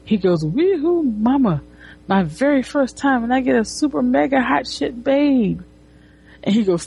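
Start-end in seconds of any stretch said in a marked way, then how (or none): 0.5-5.6 high, giddy mock-geek cheer; goofy geek. Geek thinking to himself about his luck, goofy glee. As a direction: imitate an overjoyed geek, pitched up. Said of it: "a geek would say this type of dialogue".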